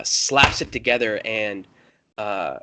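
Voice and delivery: ordinary talk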